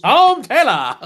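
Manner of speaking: big, loud Australian accent. Shown as a demonstration. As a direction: shout it high in an Aussie accent. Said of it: "when I did Tom Taylor".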